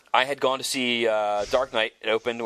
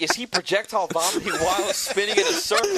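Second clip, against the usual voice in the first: frantic disbelief; 8 semitones higher than usual. Retold or imitated. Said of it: "And I'm going crazy".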